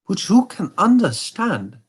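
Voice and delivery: a hint of a haughty German king; Bohemian king. King speaks.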